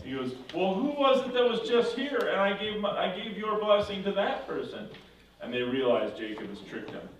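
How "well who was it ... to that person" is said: high, agitated questioning; upset father. Retold or imitated. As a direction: act out a father's loud, alarmed realisation. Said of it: "and he's so upset".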